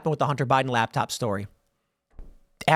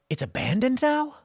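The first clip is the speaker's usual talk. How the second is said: high, incredulous exclaim; retelling his own shock, pitched well up; stunned disbelief; 6 semitones higher than usual. Retold or imitated. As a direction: ask it like you can't believe your eyes. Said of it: "and I'm like".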